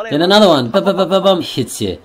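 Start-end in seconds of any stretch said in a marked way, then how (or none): 0.7-1.4 high, rapid punch noises; higher pum-pum-pum volley; imitated beating. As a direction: rattle off blows in a raised pitch. Acting out a third beating.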